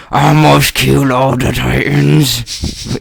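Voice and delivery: slow, lightly put-on Austrian action-hero accent; a hint of Schwarzenegger, slow and heavy; Arnold Schwarzenegger. Friend imitates Schwarzenegger reading anime lines, slow Austrian accent. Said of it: "Arnold Schwarzenegger kind of thing".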